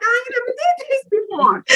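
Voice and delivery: high-pitched comic wail of panic; mock wail. New mom left alone with the baby, mock wail. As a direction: squeal it like an overwhelmed first-timer.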